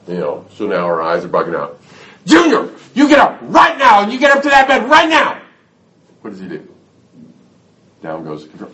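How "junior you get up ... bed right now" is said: furious, high-pitched screaming order; mother losing it. Acted. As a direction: blow up at the child at full volume. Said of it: "now she loses it".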